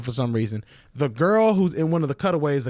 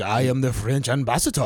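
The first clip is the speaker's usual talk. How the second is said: bad French accent. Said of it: "it was a bad French accent".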